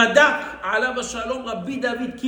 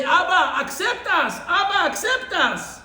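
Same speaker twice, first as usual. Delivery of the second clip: put-on children crying; 7 semitones higher, 4 dB louder than usual. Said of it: "they start to cry also".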